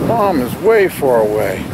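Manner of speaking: normal talking voice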